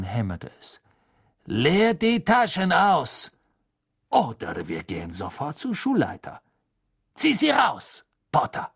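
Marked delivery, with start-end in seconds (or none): 1.5-8.0 angry command. Acted. Snape ordering Harry to empty his pockets, loud and angry. 8.3-8.7 Potter barked loud and high; loud name. Acted. Snape barking Potter's name at him.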